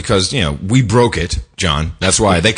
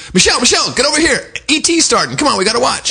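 much higher, eager summons; mock excited calling; 13 semitones higher, faster than usual. President calling his wife to the TV, hammed-up excited call. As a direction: call out high, hurrying someone over.

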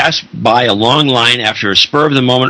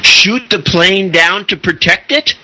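incredulous; 8 semitones higher than usual. Real, not acted. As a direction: ask it in utter disbelief. Incredulous repeated question.

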